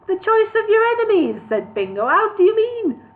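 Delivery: put-on high, loud Newcastle accent for Bingo. Hobbit Bingo querying elf in Newcastle accent. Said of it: "a Newcastle accent".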